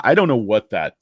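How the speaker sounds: usual speaking voice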